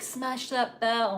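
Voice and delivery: drawn-out mock YouTuber catchphrase; imitated YouTuber. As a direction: parody a YouTuber plugging the channel, slowly. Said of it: "They're all like".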